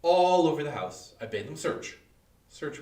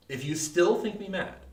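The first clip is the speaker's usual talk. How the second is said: ordinary delivery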